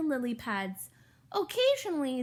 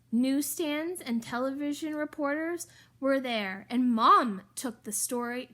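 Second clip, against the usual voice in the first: plain reading voice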